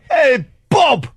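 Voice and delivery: very high mock-English shout; horrible English accent. Host hailing the intern in a shouted, horrible English accent. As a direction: greet him in a hammed-up bad English accent.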